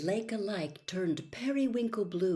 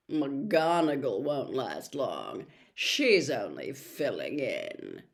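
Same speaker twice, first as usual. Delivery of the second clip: gloating Malfoy; 7 dB louder than usual. Acted. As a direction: gloat openly so they hear. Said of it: "not troubling to keep his voice down".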